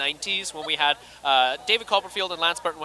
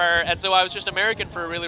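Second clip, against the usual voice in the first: normal talking voice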